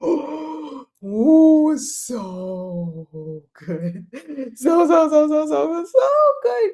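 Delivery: high, drawn-out swooning praise; over-the-top raving; gushing. Real, not acted. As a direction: gush with rapid repeated so's, rising high.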